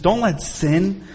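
"Don't let sin" dropped low and soft, drawn out; soft slow plea. Acted. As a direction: murmur "Don't let sin" slowly, almost to yourself. Repeated plea, first take.

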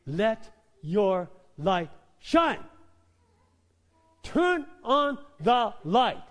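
loud, slow, high emphatic preaching; preaching at full force. Real, not acted. Preacher to congregation, loud slow emphatic preaching. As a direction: drive each phrase home slowly and loudly. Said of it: "I'm not yelling at you. I'm just doing it for emphasis".

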